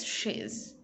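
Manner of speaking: "she's" as a single syllable; contracted form. Shown as a demonstration. Teacher showing how she apostrophe S sounds, contracted "she's". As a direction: run she and is together as "she's". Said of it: "we can pronounce this as".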